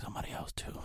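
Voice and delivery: breathy unvoiced whisper. Whispering the secret part to a date. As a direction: let the last words slip out in a whisper.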